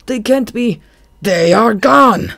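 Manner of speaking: high furious roar, slow and loud at the end; a loud, angry roar at the loss. A narrator's reading. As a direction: roar it out in disbelief. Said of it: "Goth roared again".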